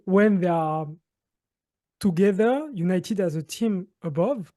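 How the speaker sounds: normal talking voice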